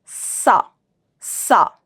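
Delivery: ordinary talk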